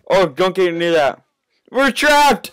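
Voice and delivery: high panicked yelling; frantic cry, voice shooting up; panicking gamer. Real, not acted. Chased by monster in game.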